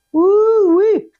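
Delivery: high, loud gleeful whoop; delighted outburst; excited whoop. Real, not acted. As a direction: cheer them on with a big woo-wee. Excited whoop over good card.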